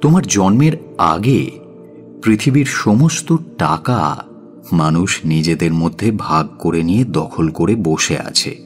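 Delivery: usual speaking voice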